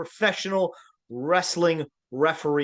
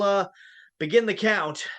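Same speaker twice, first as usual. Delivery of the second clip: ordinary talk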